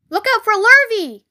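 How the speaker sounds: high, loud warning call. A narrator's reading. Cows warning Wilbur of the hired man, called warning. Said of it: "called the cows".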